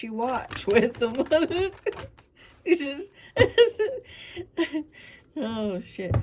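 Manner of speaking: real high, loud laughing fit; cracking up. Real, not acted. Tarot reader tickled by own crude saying, cracking up. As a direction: break up laughing mid-sentence.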